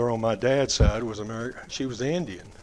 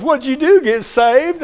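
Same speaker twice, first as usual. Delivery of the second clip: high, loud teasing question; laughing taunt; 12 semitones higher, 8 dB louder, slower than usual. Retold or imitated. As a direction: needle him, still laughing. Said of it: "he looked at me laughingly".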